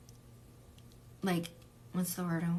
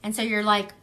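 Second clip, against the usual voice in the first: ordinary talk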